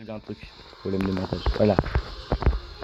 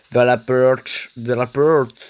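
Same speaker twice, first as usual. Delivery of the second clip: French words in a put-on English accent; silly mock English accent; 3 semitones lower, much slower than usual. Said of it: "Pourquoi je fais accent anglais".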